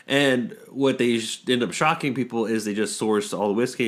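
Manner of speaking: usual speaking voice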